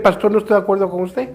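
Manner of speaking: politely disagree, softly and quickly; respectful disagreement. Retold or imitated. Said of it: "Fue una plática respetuosa".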